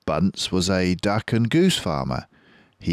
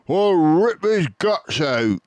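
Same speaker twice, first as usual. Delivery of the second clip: higher, louder, slow threat; Bunce threatening; 10 semitones higher, 5 dB louder than usual. Acted. Bunce vowing revenge on the fox, loud threat. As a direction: growl the threat like a brute. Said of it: "said Bunce".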